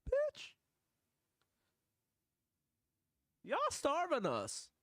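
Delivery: put-on very high child's voice, indignant; angry little kid. Reacting to a hungry child in the video, very high kid voice. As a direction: squeak it out like an outraged kid. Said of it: "This little kid's like".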